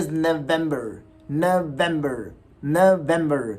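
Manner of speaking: November as nuh-VEM-ber; slow, clear November, nuh-VEM-ber; right pronunciation. Shown as a demonstration. Teacher modelling the slight change, November as nuh-VEM-ber. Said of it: "right pronunciation के साथ".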